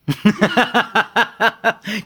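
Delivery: high bright laugh breaking out; a real laugh; burst of laughter. Real, not acted. Laughing at own joke.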